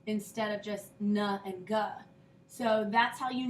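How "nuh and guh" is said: "n and g" as nuh and guh; separate letter sounds. Shown as a demonstration. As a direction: sound "n and g" out one by one. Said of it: "don't sound out the N and the G separately".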